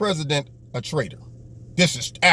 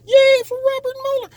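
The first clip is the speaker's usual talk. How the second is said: squeaky high mock cheer, pitched way up; high falsetto sarcastic cheer; 4 dB louder than usual.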